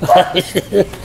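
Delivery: quick low chuckle; short laugh. Real, not acted. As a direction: laugh briefly. Laughing at the joke.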